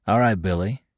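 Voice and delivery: usual speaking voice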